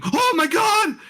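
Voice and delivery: high shrieking scream; a passenger screaming in panic. Acted. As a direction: shriek it in terror. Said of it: "a scream".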